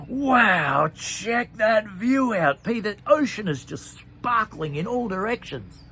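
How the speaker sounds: high, loud, slow awe at the view; amazed wonder. Acted. Bean marvels at the ocean view to Pete, loud and awed. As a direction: gush over the sparkling ocean.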